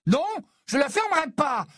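very high, defiant shout; enraged yell. A narrator's reading. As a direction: refuse at the top of your voice. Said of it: "hurla Ginny, folle de rage".

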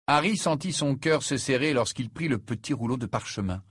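ordinary delivery

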